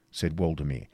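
plain reading voice

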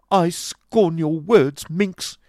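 loud, high, drawn-out disdain; theatrical high-pitched contempt; scornful outburst. Acted. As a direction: hurl the insult slowly at full force. Acted quarrel, hurling an insult.

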